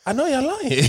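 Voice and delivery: high, knowing dismissal; half-laughing I know y'all lying; skeptical retort. Retold or imitated. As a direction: call their bluff, high and amused. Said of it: "And I just be like".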